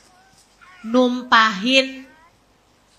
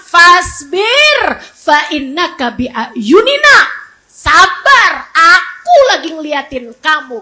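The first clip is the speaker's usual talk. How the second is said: loud, stretched emphatic quotation; forceful command; 7 dB louder, slower than usual. Acted. Preacher quoting God's call for patience, loud and emphatic. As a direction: proclaim it with full force.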